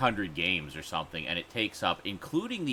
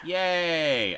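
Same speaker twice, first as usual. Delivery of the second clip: excited cheer; 8 semitones higher, 5 dB louder than usual. Real, not acted. Cheer at game.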